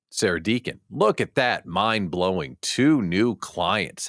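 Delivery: ordinary talk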